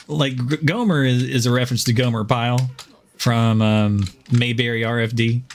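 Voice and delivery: ordinary delivery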